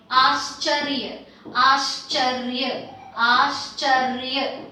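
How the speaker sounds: "ascharya" slowly, as-char-ya, part by part; clusters sounded out. Shown as a demonstration. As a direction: stretch out "ascharya", sh into ch, r into y. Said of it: "this is r plus y".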